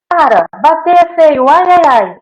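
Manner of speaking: put-on high, loud scolding of a child; scolding toddler. Teacher scolding kids fighting at daycare, loud. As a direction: scold the biting toddler sharply. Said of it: "a gente fala assim".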